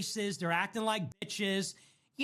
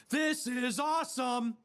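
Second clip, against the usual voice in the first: slow, savored this is awesome; slow relish; 3 semitones higher, slower than usual. Real, not acted. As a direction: drag it out with delight.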